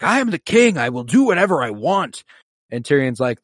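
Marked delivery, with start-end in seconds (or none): 0.0-2.2 high, loud royal tantrum, imitated; mock a furious king asserting himself; enraged Joffrey. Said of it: "now completely enraged, Joffrey's like".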